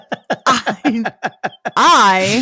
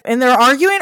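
ordinary delivery

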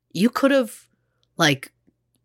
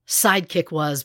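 ordinary talk

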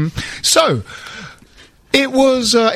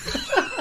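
short laugh; 14 semitones higher, 11 dB quieter than usual. Real, not acted. Laughs at own joke.

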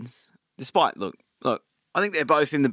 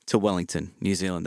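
low-key attempt at a New Zealand accent; a hint of Kiwi accent; 5 semitones lower, 4 dB quieter than usual. Shown as a demonstration. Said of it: "That was my, um, New Zealand accent".

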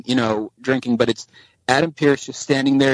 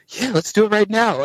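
higher-pitched keen reply; imitated eagerness; 9 semitones higher than usual. Actor offers to record podcast immediately, imitated eager reply. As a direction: jump at it, pitched up.

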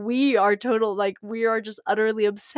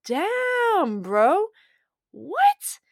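mock disbelief; 13 semitones higher, 5 dB louder, much slower than usual. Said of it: "you're like".

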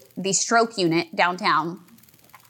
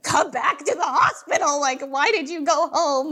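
frantic plea; 6 semitones higher than usual. Retold or imitated. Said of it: "and I'm like".

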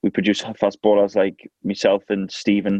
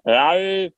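grumbling camel voice; 10 semitones higher than usual. Pretend. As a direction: bellow like a grumpy camel. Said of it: "I actually do a good camel noise".

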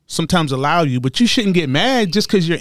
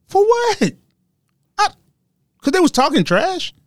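mock disbelief. Mock incredulous questions.

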